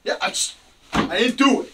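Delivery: shouting it out, pleading; desperate shout. Acted. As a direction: yell your innocence at the cop. Suspect protests innocence.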